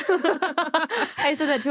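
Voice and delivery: giggling agreement; laughing. Real, not acted. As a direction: say it through real laughter. Laughing with cohost.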